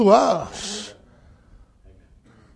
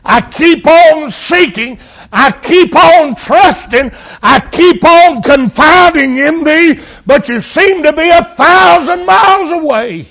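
shouted cry of the abandoned; pleading anguished Jesus; 9 semitones higher, 7 dB louder than usual. Acted. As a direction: plead harder with every line. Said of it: "he says here".

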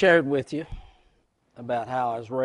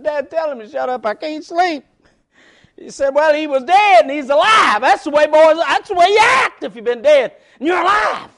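loud, fast preaching; fiery preaching; 12 semitones higher, 13 dB louder than usual. Acted. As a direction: preach it out to the congregation. Preaching at full force.

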